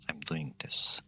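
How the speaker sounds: hushed whisper at the end. Acted. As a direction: drop to a whisper, intense. Goddess justifies herself.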